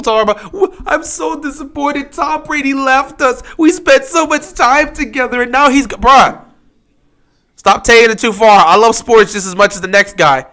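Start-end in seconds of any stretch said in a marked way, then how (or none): 0.5-5.9 mock sad fan. Mocking a crying fan over Brady. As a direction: whine like a heartbroken fan.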